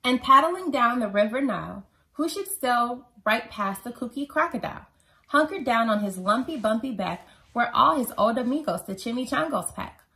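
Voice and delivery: ordinary delivery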